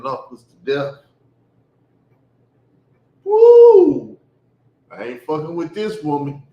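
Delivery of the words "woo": sudden very high, loud yelp; pained whoop. Retold or imitated. Man recalls mama hitting his knuckles, very high, loud yelp. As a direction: yelp as if knuckles were struck. Reliving knuckle beating pain.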